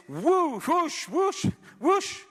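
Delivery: high mock woofing whooshes; garbled dog noises. Stroke victim trying to speak, high garbled dog-like whooshes. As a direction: come out as retriever noises instead of words. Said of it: "I sound like a golden retriever".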